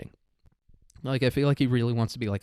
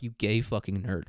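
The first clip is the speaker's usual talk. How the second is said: ordinary talk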